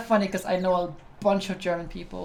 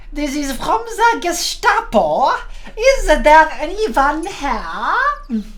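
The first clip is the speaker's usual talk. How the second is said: inflated German accent, loud, higher and drawn out; hammed-up German, slow and booming; super fake German accent; 8 semitones higher, 6 dB louder, much slower than usual. Shown as a demonstration. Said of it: "super fake German accent".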